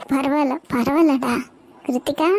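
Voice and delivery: normal talking voice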